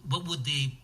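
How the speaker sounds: normal talking voice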